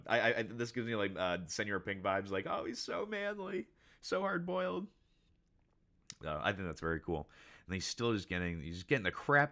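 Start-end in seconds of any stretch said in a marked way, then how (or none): 2.5-4.9 very high falsetto fawning; swooning admirer. Acted. Helpers giving in to Sanji's manliness, high-pitched swooning. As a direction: gush in a slow, high mock-fangirl voice.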